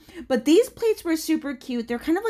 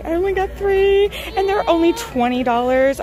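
excited; 6 semitones higher, 5 dB louder than usual. Real, not acted. Repeats it, thrilled.

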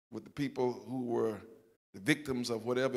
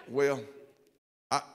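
ordinary delivery

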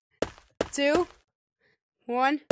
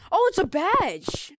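surprised; 5 semitones higher, slower than usual. Real, not acted. Finding a new item in game.

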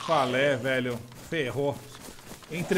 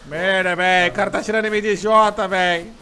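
stressed shout; 3 semitones higher, 7 dB louder than usual. Real, not acted. Being shot while fleeing.

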